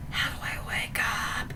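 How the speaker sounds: low, hushed mock dread; whispered dreamer's thought. Dreamer's frightened thought, retold.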